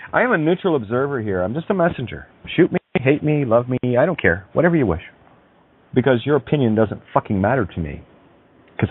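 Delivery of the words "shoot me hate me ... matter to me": usual speaking voice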